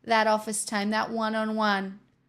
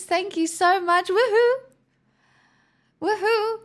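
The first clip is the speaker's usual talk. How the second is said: mock cheer; 8 semitones higher, 4 dB louder than usual. Mock celebrating a long wait.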